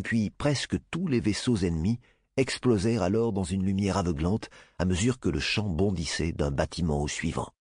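ordinary delivery, nothing marked

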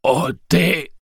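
high, loud, quavering creature cry; a frightened animal echoing its name; small beast answering in a quavering croak. A narrator's reading. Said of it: "le chevrotement de sa voix rauque".

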